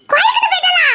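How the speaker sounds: high, loud, laughing disbelief; shrieking laugh of surprise. Acted. Surprised at friend's news.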